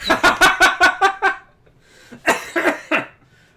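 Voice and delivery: long, high, loud laughing fit; helpless laughter. Real, not acted. Viewer laughing at the British accent, helpless laughter. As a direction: lose it laughing, in waves.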